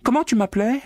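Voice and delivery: very high, mocking demand; taunting maid. A narrator's reading. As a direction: needle him for an answer. Natasha taunting the pig.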